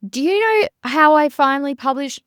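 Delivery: ordinary talk, nothing marked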